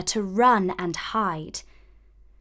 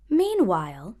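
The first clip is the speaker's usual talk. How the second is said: plain reading voice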